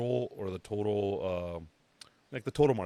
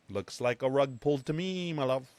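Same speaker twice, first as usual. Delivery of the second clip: teasing sweet warning; 6 semitones higher, 4 dB louder than usual. Retold or imitated. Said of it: "I was like".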